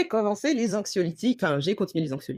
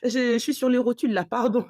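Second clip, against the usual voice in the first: high, pleading, worn-out whine; mock-dramatic tired plea; exhausted plea; 6 semitones higher than usual. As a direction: beg to be left alone, higher up. What she wanted to tell doctor.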